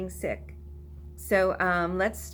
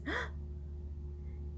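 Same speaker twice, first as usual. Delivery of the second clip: gasp; 12 semitones higher, 15 dB quieter than usual. Shown as a demonstration. Mock surprise in song.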